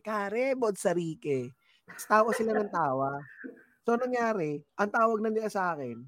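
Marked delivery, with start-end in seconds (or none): 0.0-1.5 "Kare Botsarike" drawn out slowly; slow foreign phrase. Retold or imitated. Greeting his coworkers with the swear, slow and proud. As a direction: savor "Kare Botsarike" word by word. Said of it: "ako lagi sinasabi".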